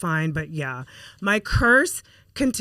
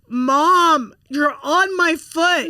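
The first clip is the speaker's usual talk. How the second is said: high, loud pained wail, acted out; imitated tearful daughter; 8 semitones higher, 5 dB louder, much slower than usual. Daughter under the car wheel to mom, imitated anguish. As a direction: wail it like a hurt child. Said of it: "the tears are at the bottom".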